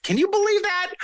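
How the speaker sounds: high-pitched disbelief; incredulous. Real, not acted. Storyteller marvelling at his own airport request, incredulous. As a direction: let the question leap up high in amazement.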